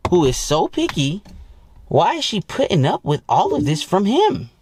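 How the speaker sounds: high excited gossiping voice; curious girlfriends. Retold or imitated. Girlfriends gossiping about a bad boyfriend, in a high excited voice. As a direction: wonder aloud in a high gossipy voice. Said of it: "that makes bitches' pussies wet".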